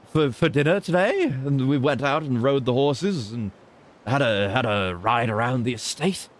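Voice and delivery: slow, plummy upper-class English gent; lightly voiced posh Englishman with a drawn-out oh dear; posh British bloke. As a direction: play the lord of the manor musing on dinner. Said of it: "sounding like a Pipitcherio type of bloke".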